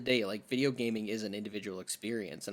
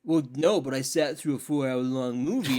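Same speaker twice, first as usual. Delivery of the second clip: louder, slightly sheepish boast; mock defensive moviegoer; 5 dB louder than usual. Imitating a viewer defending a wasted four hours, louder. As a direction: play the stubborn viewer, a bit louder. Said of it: "and it's like".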